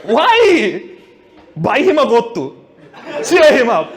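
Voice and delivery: a lightly voiced friend egging on; a hint of a jeering mate; mock friend. Friend mocking the crying man.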